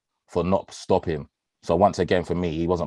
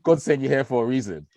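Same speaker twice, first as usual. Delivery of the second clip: mock praise; 7 semitones higher than usual. Praising friend who brought Hennessy.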